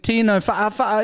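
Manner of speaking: high stuttering repeat of a name; lightly mocked commentator stuck on a syllable; mock DJ remix. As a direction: imitate a DJ looping a syllable. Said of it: "He sounded like he was DJ".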